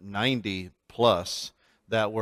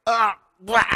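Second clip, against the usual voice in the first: much higher, loud disgusted noise; exasperated outburst; 10 semitones higher, 5 dB louder than usual. Retold or imitated. Teller reacting to the guide, exasperated. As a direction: throw up your hands in disgust. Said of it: "I'm just like".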